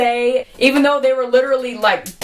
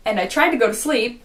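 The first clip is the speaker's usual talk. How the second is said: ordinary delivery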